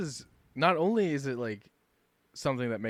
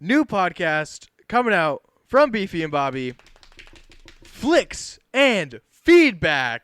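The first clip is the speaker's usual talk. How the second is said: louder and higher, title stretched out slowly; grand reveal; 5 semitones higher, 11 dB louder, slower than usual. Pretend. Host revealing the new podcast title to listeners, drawn-out announcer reveal. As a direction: unveil the name like a showman. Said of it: "Only with a drum roll".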